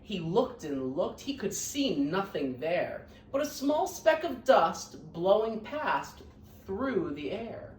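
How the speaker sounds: lively, sing-song storybook reading; reading to children. A narrator's reading. Teacher reading Horton Hears a Who to her scholars, lively storybook voice. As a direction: read it out warmly to a class of kids.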